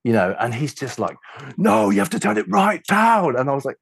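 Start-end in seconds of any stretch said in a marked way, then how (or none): 1.6-3.4 high, agitated mock order; distressed demand. Annoyed man orders the engineer to turn down, agitated imitation. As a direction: insist in a raised, worked-up pitch. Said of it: "he was so distressed".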